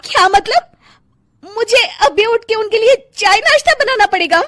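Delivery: high, loud outraged question; dismayed disbelief. Acted. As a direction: protest the early chore. Wife dismayed at cooking duty.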